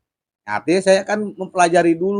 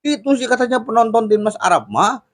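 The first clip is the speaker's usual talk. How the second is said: ordinary delivery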